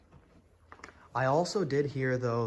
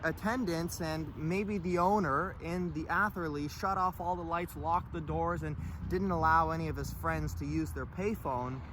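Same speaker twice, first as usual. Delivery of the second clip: raised, upbeat presenter voice; vlog intro narration; 8 semitones higher than usual. Acted. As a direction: announce it like a show host.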